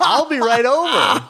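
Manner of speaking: very high, eager mock acceptance; eager reply. Friend re-enacting his yes to his idol, high and eager. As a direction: jump at the invitation in a squeaky high voice.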